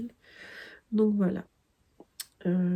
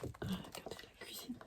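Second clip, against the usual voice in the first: hushed whisper; 21 dB quieter than usual. Real, not acted. Admiring book illustration.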